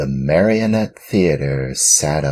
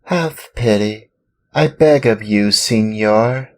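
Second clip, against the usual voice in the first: pitiful plea; 8 semitones higher, 6 dB louder than usual. Acted. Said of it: "in a pitiful voice which could scarcely be heard".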